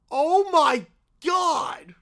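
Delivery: slow, loud oh my God; real disbelief; shocked. Real, not acted. Reacting to attack scene.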